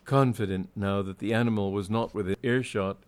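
ordinary delivery

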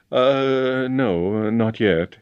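a stalling, uneasy admission; hesitant. A narrator's reading. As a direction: hedge nervously under questioning. James falters under questioning.

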